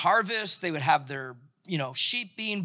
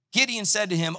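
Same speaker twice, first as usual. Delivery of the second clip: normal talking voice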